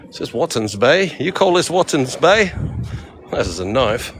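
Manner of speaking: badly faked Australian, swooping up on the question; Australian accent. Tourist adapting a knife quote to the bay, mock Australian. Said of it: "how do you say that in Australian accent?".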